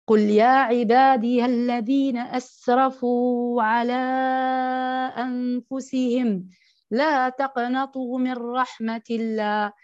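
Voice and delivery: slow, drawn-out chanted recitation of the verse; Quran recitation. Acted. Preacher to women, reciting the verse of mercy in slow chanted tajwid. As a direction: let each word ring out slowly, like tajwid.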